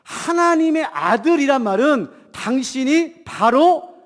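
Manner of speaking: normal talking voice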